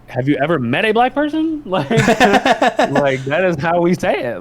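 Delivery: high-pitched mock disbelief, breaking into laughter; incredulous comeback. Retelling a comeback to coworkers about auntie, incredulous and high.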